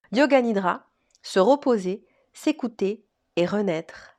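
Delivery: measured, articulated presenter read with pauses between phrases; scripted podcast intro. Acted. Host welcomes listeners and presents today's yoga nidra guest, scripted intro read. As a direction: read it as a prepared episode introduction.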